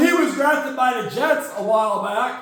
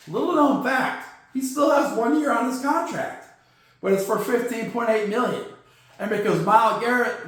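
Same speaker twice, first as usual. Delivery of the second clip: ordinary talk, nothing marked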